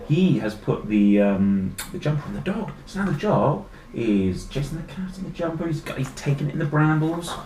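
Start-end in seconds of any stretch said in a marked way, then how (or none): none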